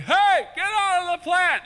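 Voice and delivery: high, slow shouted scolding; yelling at the cat. Retold or imitated. Owner yelling at cat in the plant, high shout. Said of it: "It was like".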